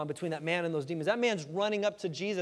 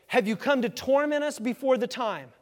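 scared pleading; 7 semitones higher, 7 dB louder than usual. Acted. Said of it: "They're scared to death of him".

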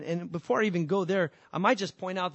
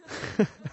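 a short airy laugh; breathy laugh. Real, not acted. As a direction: let a quick laugh escape. Laugh alone.

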